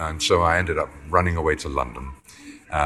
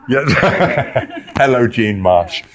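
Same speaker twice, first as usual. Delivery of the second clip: laughing; 7 semitones higher, 6 dB louder, slower than usual. Real, not acted. Greets passing actress mid-interview.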